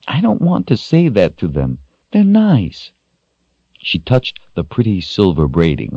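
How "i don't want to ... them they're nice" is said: put-on high-pitched child's protest; reluctant child. A narrator's reading. Rachel objects to scolding the washwomen, reluctant child. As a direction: protest like a child, rising at the end.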